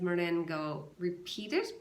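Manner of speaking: usual speaking voice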